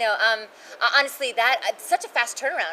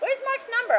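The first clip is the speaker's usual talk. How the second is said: mock fan question; 4 dB louder than usual. People are like.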